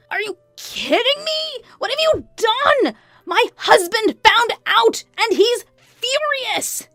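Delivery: very high, drawn-out hysterical yell; yelling over phone. A narrator's reading. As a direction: scream as if cracking the speaker. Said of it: "yelled at her over the phone".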